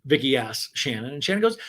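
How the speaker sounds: normal talking voice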